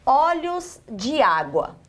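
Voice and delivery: olhos de água slowly, each word kept separate; unelided demonstration. Shown as a demonstration. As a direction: draw out olhos de água with the de fully sounded. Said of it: "Eu suprimi essa vogal aqui".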